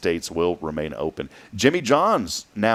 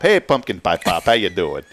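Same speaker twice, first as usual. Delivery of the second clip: mock northern accent; 2 semitones lower, 4 dB louder than usual. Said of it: "Sounds kinda northern".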